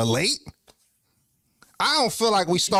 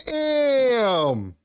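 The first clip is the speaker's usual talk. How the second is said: long drawn-out word, high and sliding down; stung disbelief; 6 semitones higher than usual. Real, not acted. Streamer stung by chat's no-show jab, drawn-out dismay. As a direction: stretch it out like the blow just landed. Reacts to being called a no-show.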